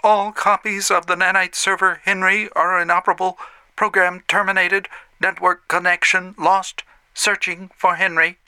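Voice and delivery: high, flat robotic announcement; mechanical system voice. A narrator's reading. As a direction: read it like a computer system. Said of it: "mechanical voice said".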